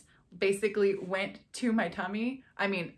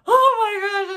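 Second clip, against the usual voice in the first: loud, high, emotional "oh my gosh"; overwhelmed outcry; 13 semitones higher, 12 dB louder than usual. Retold or imitated. Hearing a third heartbeat, loud high overwhelmed cry. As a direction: burst out as the tears start. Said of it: "and I just started crying".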